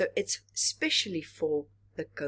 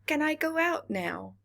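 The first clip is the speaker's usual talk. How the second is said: higher, mock child asking to go out; a child begging parents to go out; pleading child; 9 semitones higher than usual. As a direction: ask it like an eager kid.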